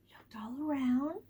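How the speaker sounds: hushed whisper. Shown as a demonstration. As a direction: whisper as if peeking around. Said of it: "whispers action".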